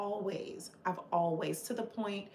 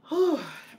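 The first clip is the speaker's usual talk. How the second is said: a loud, higher sigh that falls away; heavy sigh; 9 semitones higher, 8 dB louder than usual. Real, not acted. Streamer steadying herself against tears in front of chat, a loud falling sigh. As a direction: let out a big sigh to steady yourself.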